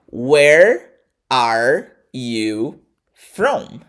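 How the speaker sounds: "Where", "are", "you", "from" each alone with pauses; full word-by-word pronunciation. Shown as a demonstration. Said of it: "ao invés de falar".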